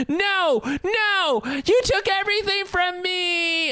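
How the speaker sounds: high, pleading wail, hammed up; mock anguished cry. Friend acting Xavier grieving at Magneto, hammed-up high wail. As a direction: cry out the loss like a wounded friend.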